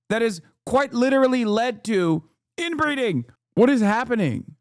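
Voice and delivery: rising pitch, incredulous and heated; can't believe what he's seeing; high exasperated disbelief; exasperated rant. Real, not acted. Exasperated rant about eugenics rally.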